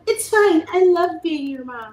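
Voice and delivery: high, loud, syrupy fake patience; sugar-coat it through clenched patience; mock sweet. Mock-sweet reply to messy kids.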